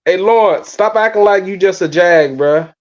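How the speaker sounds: normal talking voice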